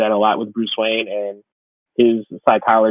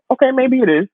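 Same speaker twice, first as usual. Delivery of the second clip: sudden high-pitched grudging admission; mock realization; 12 semitones higher, 3 dB louder than usual. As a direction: squeak it out like it just dawned on you. Mock realization of feeling old.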